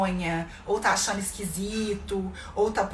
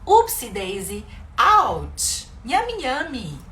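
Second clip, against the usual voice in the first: playful English exclamations; 2 semitones higher, 5 dB louder, much slower than usual. Shown as a demonstration. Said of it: "Olha como a gente pode ir colocando".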